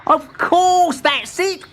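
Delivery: very high, eager realisation; excited cry. A narrator's reading. Timbertwig solves the riddle, high excited cry. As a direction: burst out with the answer. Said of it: "cried Timbertwig".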